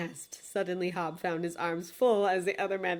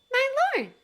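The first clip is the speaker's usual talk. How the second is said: high, eager little bird voice; much higher, bright bird cry; raven's voice; 6 dB louder than usual. Acted. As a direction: squawk out the greeting like a small raven. Raven greets her master.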